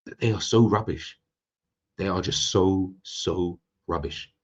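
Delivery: "so" leaned on and drawn out before "rubbish"; slow down and weigh each "so" before "rubbish"; deliberate pauses round "so", hammering home "rubbish"; emphatic. Real, not acted. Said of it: "emphasize what I'm trying to say".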